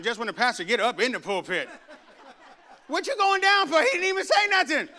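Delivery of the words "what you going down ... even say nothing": high, laughing, incredulous; mocking high voice. Congregant mocking a brother who runs down early, high and laughing. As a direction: tease him for running down so early.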